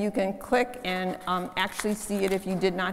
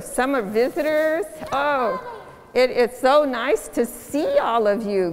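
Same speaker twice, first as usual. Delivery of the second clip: put-on higher, louder, bright children's-sermon voice; talking to children; 4 semitones higher, 4 dB louder than usual. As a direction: welcome the little ones with delight. Children's time with kids up front.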